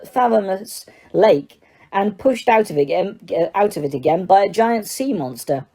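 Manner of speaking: ordinary delivery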